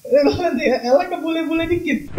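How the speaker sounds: high-pitched, through laughter; teasing, breaking into giggles; laughing. Real, not acted. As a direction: crack up at your own joke. Joking about posing as a Westerner.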